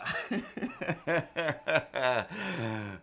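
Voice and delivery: long real laugh. Real, not acted. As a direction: crack up at yourself. Laughing at own voice.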